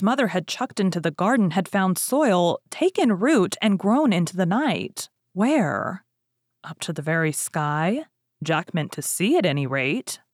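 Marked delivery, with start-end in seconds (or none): none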